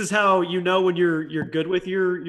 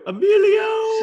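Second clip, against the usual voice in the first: mock call-out; much slower than usual. Calling out the meme name.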